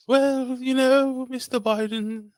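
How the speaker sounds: high, slow mock posh English lady; mock English accent. Host playing the Queen of England in a mock English accent. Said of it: "I can't do a real English accent".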